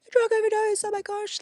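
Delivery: high, strained cry of grief; anguished cry. Retold or imitated. Daughter answering her mum, high anguished cry. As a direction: cry out the cause of death.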